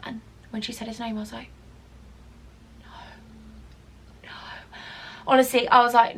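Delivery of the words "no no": barely audible, breath-only refusals; hushed dread. Retold or imitated. Doorwoman hearing the husband's name, whispered dread. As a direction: let it slip out in horror.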